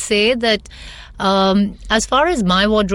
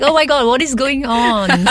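higher, louder mock dismay; imitating exasperated husband; 7 semitones higher, 5 dB louder than usual. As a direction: lightly mimic a husband's exclamation. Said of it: "And he's like".

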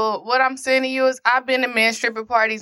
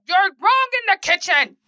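chef voice; 7 semitones higher than usual. Retold or imitated. Imitating a Top Chef judge scolding a cook in the kitchen. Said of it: "Was that the wrong voice?".

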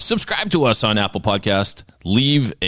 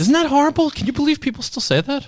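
shrill, much higher indignant squeal; mock outrage. Host reacting to the networking story, mock outrage. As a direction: play up the shock for laughs.

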